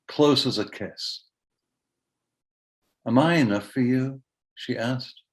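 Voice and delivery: poem reading. Acted. Poet reads the poem about the mother of his kids, measured poetry reading. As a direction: read in spaced phrases with long pauses.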